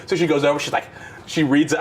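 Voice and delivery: usual speaking voice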